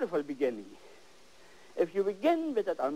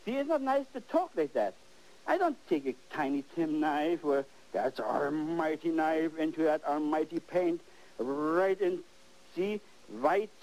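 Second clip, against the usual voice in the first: ordinary delivery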